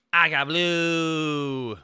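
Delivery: very slow, BLUE held long; drawn-out announcement. Pretend. Streamer offering the other drink, long drawn-out announcement. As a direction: stretch the last word right out.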